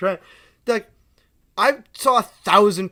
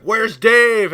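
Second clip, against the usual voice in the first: higher, loud heckler's call; shout from the back; 6 semitones higher, 11 dB louder than usual. Retold or imitated. Drunk customer calling from the back of the shop, shouting. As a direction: holler it over a crowd. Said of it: "from the back".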